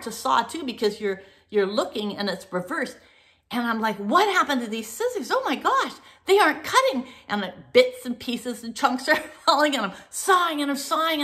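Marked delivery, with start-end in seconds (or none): 4.0-7.1 panic. Retold or imitated. Panicking mid-haircut.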